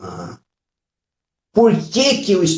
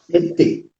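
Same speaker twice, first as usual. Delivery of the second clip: laughing; 4 semitones higher than usual. Real, not acted.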